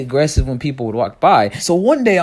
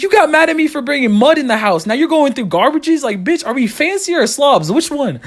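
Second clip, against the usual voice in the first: indignant rant; 9 semitones higher than usual. Retold or imitated. Son ranting at mom about mud and garbage, high and indignant. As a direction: demand an answer, fed up.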